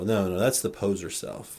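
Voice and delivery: usual speaking voice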